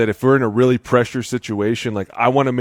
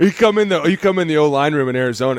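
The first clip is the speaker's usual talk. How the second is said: ordinary talk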